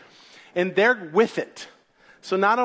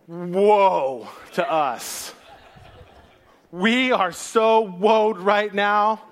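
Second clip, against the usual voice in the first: drawn-out woe; much slower than usual. Acted. Philistines drawn out in dread.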